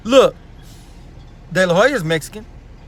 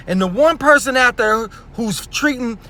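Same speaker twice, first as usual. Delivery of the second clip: usual speaking voice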